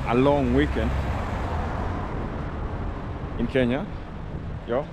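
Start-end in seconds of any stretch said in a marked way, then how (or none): none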